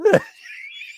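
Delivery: very high squealing laugh; squeaky laughing fit; helpless laughter. Real, not acted. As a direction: burst out in a high cackle. Laughing at the next figure.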